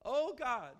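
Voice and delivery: high anguished cry, rising to loud. Acted. Preacher acting a desperate lament to God. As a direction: wail it out to God in distress. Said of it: "crying out to him".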